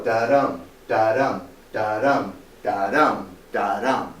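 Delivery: da-dum in five even pairs, DUM stressed; iambic rhythm. Shown as a demonstration. Teacher beating out iambic pentameter, da-dum with DUM stressed. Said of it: "Each alternate syllable is stressed".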